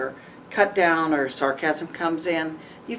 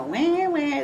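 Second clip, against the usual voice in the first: high, drawn-out mock wail; mocking crybaby voice, pitched way up; mock whining; 10 semitones higher than usual. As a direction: imitate petty complainers with a long whine. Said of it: "They're gonna go".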